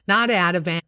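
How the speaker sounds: ordinary talk, nothing marked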